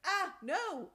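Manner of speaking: sudden high loud cry of horror; a sharp shocked "no" as a friend falls; horrified cry. Acted. Violet cries out at death.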